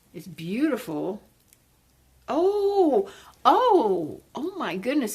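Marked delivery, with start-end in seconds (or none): 2.3-5.1 high, loud oh oh oh; thrilled gasp. Real, not acted. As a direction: squeal over a gift plant. Delighted at new plant.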